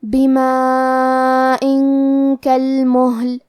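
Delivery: بما ان کل محل very slow, long four-count madd, nasal hum before کاف; madd and ghunnah recitation. Shown as a demonstration. Teacher demonstrating بما ان کل محل, drawn-out chant. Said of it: "غنہ کے ساتھ ادا کریں گے".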